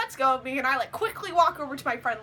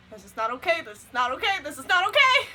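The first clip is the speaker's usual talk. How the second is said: mounting panic; 5 dB louder than usual. Retold or imitated. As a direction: repeat it with rising mock alarm. Inner panic repeated, building.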